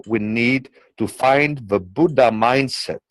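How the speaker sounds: ordinary delivery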